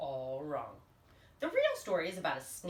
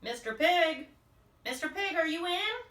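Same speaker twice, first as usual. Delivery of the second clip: louder, higher call through the door; 7 semitones higher, 5 dB louder than usual. Acted. Wolf calling the second pig, raised voice. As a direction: hail the pig, raised and bright. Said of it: "I called".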